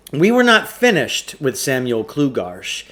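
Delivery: usual speaking voice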